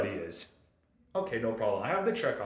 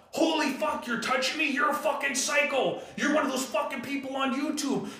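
much higher, panicked hysterical rant; mock freak-out; 10 semitones higher than usual. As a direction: play the old guy losing it completely. Worker freaking out, retold.

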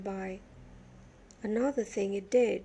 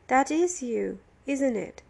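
louder, annoyed confrontation; irritated prisoner sure he has found the culprit; accusing; 3 semitones higher, 6 dB louder than usual. A narrator's reading. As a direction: pin him down accusingly. Prisoner accuses Billy in story.

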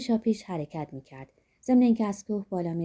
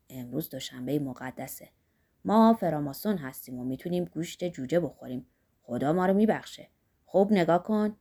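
lightly voiced Zorba, lower than the narration; Zorba, teasing companion; 6 semitones lower than usual. A narrator's reading. Zorba offers the hungry monk his food, lightly teasing. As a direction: drop a little low for Zorba's mild teasing.

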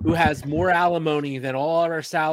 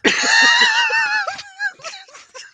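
creepy cackle; 7 dB louder than usual. Pretend. Creepy voice bit.

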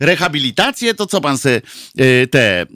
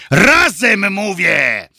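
raised, insistent rallying shout; commanding shout; 5 semitones higher, much slower than usual. A narrator's reading. Boss grabs the candidate's lapels, shouts the order. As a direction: order them all to join, voice up. Coach orders everyone to join in.

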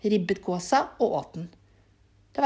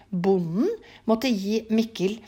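usual speaking voice

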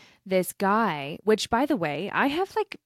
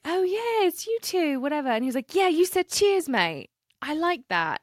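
put-on higher, delighted British accent; imitated British accent; 9 semitones higher, 4 dB louder than usual. Camper recognises them at the farmer's market, imitated British accent. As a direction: put on the Englishman's accent, pitched well up. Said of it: "he has an accent".

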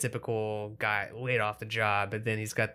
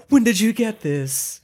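high, loud, slow overacted line; mock a stiff actor's line; bad acting imitation; 12 semitones higher, 10 dB louder, slower than usual. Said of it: "it's just so bad, the delivery is".

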